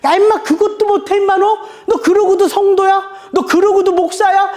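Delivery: high, laughing, teasing scold; hearty laughing rebuke. Retold or imitated. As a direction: laugh while scolding like a rough old friend. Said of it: "이렇게 털털 웃으면서".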